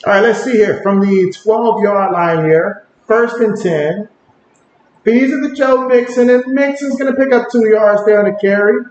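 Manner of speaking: casual live play-by-play, lifting on the action; play-by-play call. Acted. Streamer narrating the game for chat, play-by-play. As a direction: call each snap as it happens.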